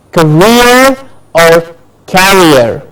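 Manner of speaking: career as "kuh-REER", carrier as "KA-ree-er", drawn out; careful pronunciation. Shown as a demonstration. Teacher separating two look-alike words, slow careful pronunciation. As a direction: slowly set career against carrier. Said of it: "remember how I am pronouncing each word".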